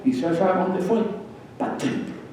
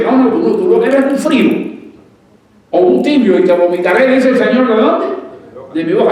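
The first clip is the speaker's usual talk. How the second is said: loud, high rebuke in God's words; preaching God's warning at full force; fervent preaching; 7 semitones higher, 6 dB louder than usual. Real, not acted. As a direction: thunder the verse at the lukewarm.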